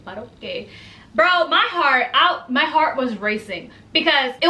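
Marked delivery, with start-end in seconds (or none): 0.0-0.7 soft, slow, resigned reply; mock resigned. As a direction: trail off, softer, slowly. Telling celebrity no refunds.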